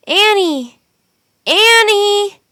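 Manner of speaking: high, loud call across the wind. A narrator's reading. Jack calling for his sister in the blizzard, loud and high. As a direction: shout her name into the storm. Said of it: "Jack called".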